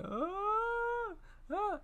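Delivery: high imitated wailing; mock wailing. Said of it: "screaming and crying in unison".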